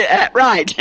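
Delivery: put-on chuckles through the old chief's line; lightly voiced chief reply, laughing; laughing punchline. Preacher delivering the punchline while laughing.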